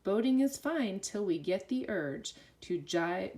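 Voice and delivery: ordinary delivery, nothing marked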